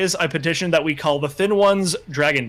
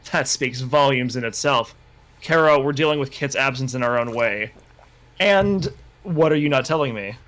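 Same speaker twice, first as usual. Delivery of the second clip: ordinary delivery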